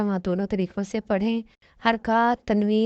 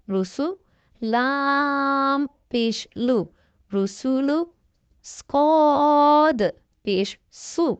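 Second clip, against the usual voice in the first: slow, drawn-out syllable spelling; teacher reading the Qaida aloud slowly; spelling drill; 3 semitones higher, much slower than usual. Shown as a demonstration. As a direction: sound out each letter slowly for a child. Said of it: "آگے انہوں نے پیش کی پہچان دی".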